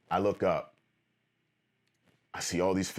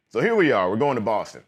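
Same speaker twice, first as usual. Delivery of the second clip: loud, higher burst of excitement; excited; 4 semitones higher, 6 dB louder than usual. Real, not acted. Telling viewers about finally heading to Boston, excited. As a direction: kick off the trip with a jolt of energy. Arriving at the big trip.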